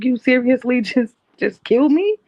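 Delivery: high, loud, incredulous with a laugh; can't believe he just did that; stunned half-laughing question; shocked disbelief. Retold or imitated. Disbelief right after being choked.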